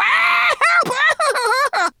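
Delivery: very high, loud cackle; villainous laugh. A narrator's reading. Dragon gloating over his prey, villainous cackle. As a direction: cackle wildly with cruel glee.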